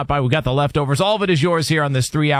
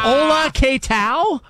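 mock Spanish greeting; 11 semitones higher, slower than usual. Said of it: "put on a sombrero".

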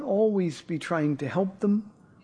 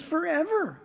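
pastor mimicking the boy's proud reply, high and light; little boy voice; 10 semitones higher than usual. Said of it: "He goes".

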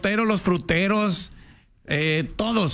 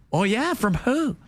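mock gringo; 5 semitones higher, slower than usual.

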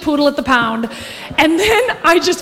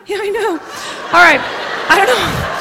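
ordinary talk, nothing marked

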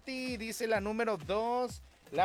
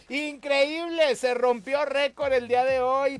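excited announcement; 5 semitones higher, 7 dB louder than usual. Real, not acted. Radio host announces a voting record, loudly thrilled. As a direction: proclaim a broken record with big energy.

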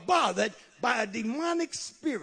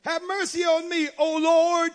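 high, loud plea; crying out; 8 semitones higher, 9 dB louder than usual. Retold or imitated. Woman addressing the Lord, loud crying out. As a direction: call out high, swelling louder at the end.

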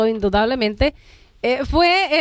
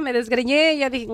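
usual speaking voice

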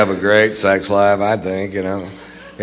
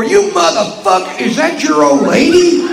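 very high-pitched mock-outraged intruder; high, incredulous confrontation; mock outrage; 3 dB louder than usual. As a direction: burst in yelling, pitched way up. Acts out an outraged bedroom intruder.

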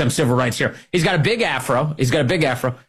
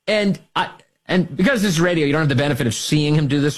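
ordinary talk

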